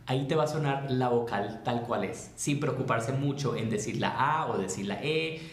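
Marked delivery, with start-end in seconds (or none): none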